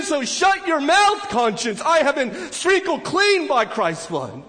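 high, loud, slow defiant rebuke; defiant declaration. Pretend. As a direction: tell your conscience to shut up, with conviction. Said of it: "look back in God's word and say".

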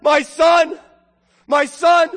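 high, very loud father's cry; joyful yelling. Acted. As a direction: shout it out to a son far down the road. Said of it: "yelling at the top of his lungs".

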